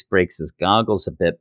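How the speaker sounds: usual speaking voice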